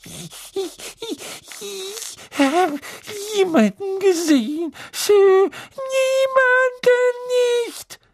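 very high strangled elf squeak. A narrator's reading. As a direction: choke the words out stammering. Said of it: "Dann würgte sie hervor".

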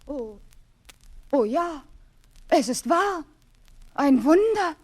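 growing excitement. Acted. Cinderella sees the miracle.

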